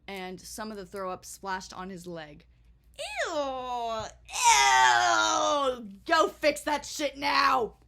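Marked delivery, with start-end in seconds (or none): 3.0-7.7 loud, higher screech of disgust; loud grossed-out yell, slow on the last line; disgusted shout. Retold or imitated. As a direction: yell it in revolted horror. Disgust at vomit on leg.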